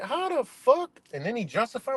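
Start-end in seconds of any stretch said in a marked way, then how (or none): 0.0-0.9 high stunned how; shocked disbelief. Retold or imitated. Vlogger recalling first hearing it, high and stunned. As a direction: pitch shoots up in disbelief. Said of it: "I'm like".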